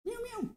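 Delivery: high, soft imitated meows; soft meow at cat. Host meowing to lure her cat, soft and high.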